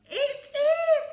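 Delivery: high loud it is; hammed-up glee; excited gasp. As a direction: burst out in delight. Excited discovery.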